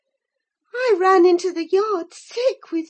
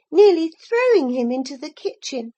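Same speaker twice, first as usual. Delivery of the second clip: ordinary delivery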